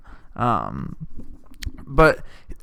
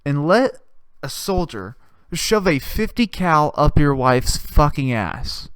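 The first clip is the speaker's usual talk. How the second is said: ordinary talk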